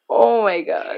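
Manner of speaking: ordinary talk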